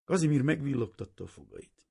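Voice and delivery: usual speaking voice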